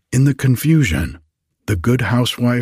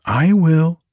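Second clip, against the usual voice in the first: high, eager little pipe-up; small character's high-pitched offer; little bannock voice; 8 semitones higher, 4 dB louder than usual. A narrator's reading. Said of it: "said a little barley bannock".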